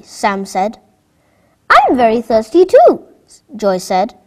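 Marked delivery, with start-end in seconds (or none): 1.7-3.1 put-on thirsty child. Second child echoes thirst.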